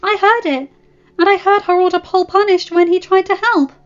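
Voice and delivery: put-on high, urgent girl telling all; spilling the secret eagerly. A narrator's reading. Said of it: "invigorated by the praise".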